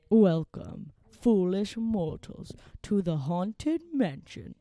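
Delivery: slow, looming theatrical villain menace; Haunted Mansion ghost host. Retold or imitated. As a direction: drag it out like a sinister ghost host.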